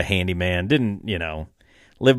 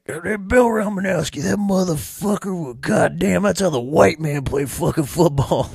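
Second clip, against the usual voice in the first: much higher hammed-up uncle voice; imitating the uncle; 6 semitones higher than usual. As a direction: rave about the football tape like the stoned uncle. Uncle praising football tape.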